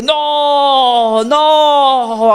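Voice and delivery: high, drawn-out cry of "no"; imitated scream. Preacher re-enacts a scream heard from inside the house, imitated high scream. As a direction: wail it out high, mimicking someone screaming. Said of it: "alguien empieza a gritar y dice".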